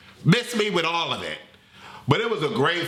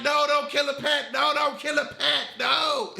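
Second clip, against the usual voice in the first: panicked plea; 8 semitones higher than usual. Retold or imitated. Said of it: "And then I'm like".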